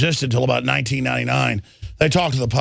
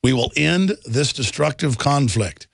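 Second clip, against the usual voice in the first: ordinary talk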